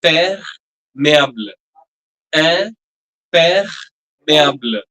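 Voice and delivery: Imperméable drawn out very slowly, each syllable apart; slow model pronunciation. Shown as a demonstration. Teacher to class, slow clear model pronunciation. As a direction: stretch Imperméable out slowly for learners to copy. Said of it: "Try to repeat after me".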